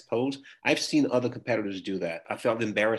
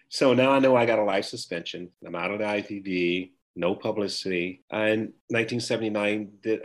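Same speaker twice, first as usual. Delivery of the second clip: ordinary talk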